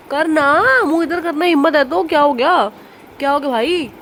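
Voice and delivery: loud teasing challenge. Real, not acted. Sister taunting her brother about hidden shoes, loud playful challenge. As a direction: dare him to face you while he laughs.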